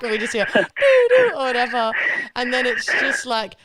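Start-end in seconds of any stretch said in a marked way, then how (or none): 0.5-1.3 loud, high siren whoop; imitated police siren. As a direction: mimic a police siren with your voice.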